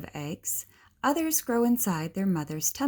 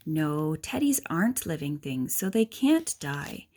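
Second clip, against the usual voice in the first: ordinary talk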